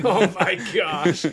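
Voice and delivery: slow, drawn-out words through laughter; laughing disbelief. Real, not acted. Reacting to the not anymore joke, laughing disbelief. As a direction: groan it out while cracking up.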